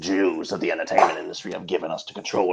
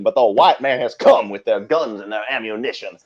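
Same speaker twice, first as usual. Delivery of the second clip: ordinary talk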